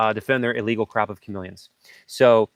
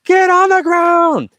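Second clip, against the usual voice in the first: much higher, loud slow yell of warning; frantic command shouted; urgent shout; 9 dB louder, slower than usual. Retold or imitated. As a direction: bellow it like bullets are flying. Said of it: "I'm like".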